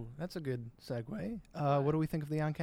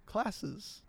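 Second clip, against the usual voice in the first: voice breaking; 7 semitones higher than usual. Real, not acted. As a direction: voice cracks on the word. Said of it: "your voice, like, broke".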